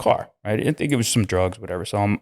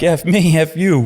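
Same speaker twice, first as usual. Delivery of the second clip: pitched-up mock snap back; defiant comeback; 7 semitones higher, 4 dB louder than usual. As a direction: fire the insult right back at him. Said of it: "And I'm like".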